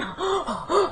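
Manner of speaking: sharp high gasp for air; panicked gasp. Retold or imitated. Panicking in the elevator, hyperventilating gasp. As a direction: gasp like you can't breathe.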